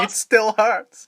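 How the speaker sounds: high, loud, drawn-out mock complaint; mock wounded victim. Imagined message from the slapped classmate, in mock hurt. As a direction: stretch it into a playful pained whine. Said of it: "you get the occasional message".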